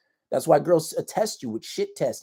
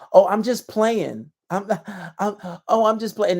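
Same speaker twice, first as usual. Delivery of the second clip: mock girl's playful voice; 6 semitones higher, 4 dB louder than usual. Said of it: "what they'll say".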